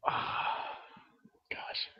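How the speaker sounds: breathless whisper under the breath; just a whisper of dismay; whispered gosh. Real, not acted.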